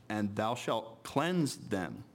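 "cleanse" weighted, the whole phrase slow; slow down and dwell on "cleanse"; stress on cleanse. Shown as a demonstration. Preacher marking "cleanse" for the congregation, slow and stressed. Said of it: "I keep emphasizing the word cleanse".

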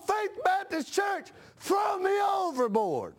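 slow, high, shouted plea; pleading shout. Acted. Mock sinner begs congregation.

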